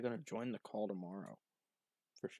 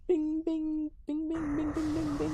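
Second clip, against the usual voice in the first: notification pings; 8 dB louder, slower than usual. Retold or imitated. Imitating Discord notification spam.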